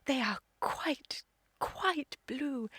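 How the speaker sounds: slow, half-whispered admiration; enraptured murmur. Acted. Young woman admiring her suitor's eyes, hushed and enraptured. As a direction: linger dreamily on each word, hushed.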